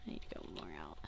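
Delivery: whispered to herself; barely voiced, under the breath; whispered aside. Real, not acted. Muttering to herself mid-game, whispered.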